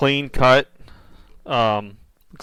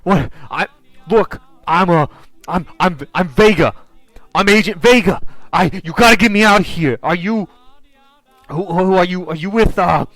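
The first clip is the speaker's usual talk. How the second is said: high, loud, stammering urgency; acted desperate captive; frantic pleading; 5 semitones higher, 5 dB louder than usual. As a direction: plead urgently, tripping over your words. Voicing captive agent begging for release.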